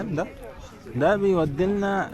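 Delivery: ordinary delivery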